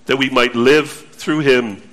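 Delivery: low, measured reading of the Bible verse; scripture reading. Acted. Pastor reading scripture to the congregation, solemn and measured.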